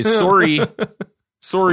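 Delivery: a hint of Canadian in sorry; mock Canadian sorry. Host keeps saying sorry in a mild Canadian accent. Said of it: "start to sound a little Canadian".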